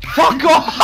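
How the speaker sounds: high, loud shocked outburst; startled yell. Real, not acted. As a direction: yell out in disbelief. Startled by being hacked in game.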